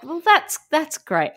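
high-pitched, halting encouragement; Anthony. Retold or imitated. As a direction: imitate a children's presenter praising a child. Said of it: "And then Anthony's like".

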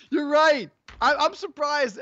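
loud, high, still laughing. Real, not acted. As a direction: say it through the laugh. Laughing after the bit.

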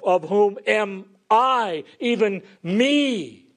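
slow, high stress on "I" and "me"; emphatic self-reference. Retold or imitated. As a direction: stretch out "am I" and "me" with preaching weight. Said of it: "if you want to emphasize talking about yourself".

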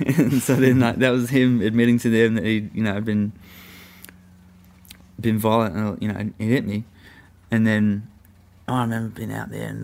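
ordinary talk

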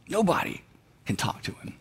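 hushed, unvoiced whisper of a warning. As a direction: lean in and whisper it into her ear. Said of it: "She whispers in Abigail's ear".

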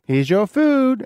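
mock chirpy robot greeting. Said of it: "It comes out with your food and says".

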